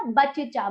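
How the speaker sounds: बच्चा wrongly as ba-che-chaa; wrong full च. Shown as a demonstration. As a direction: add a vowel inside बच्चा after first च. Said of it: "अशुद्ध उच्चारण है".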